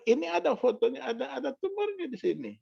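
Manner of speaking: high-pitched doctor explaining; imitating the doctor. Retells doctor showing the scan.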